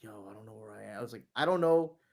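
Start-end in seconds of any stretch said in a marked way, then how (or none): 0.0-1.0 much softer, lost and drained; a hushed aside to himself; softly to himself. Retold or imitated. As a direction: say it under your breath, half to yourself. Retold being lost at night.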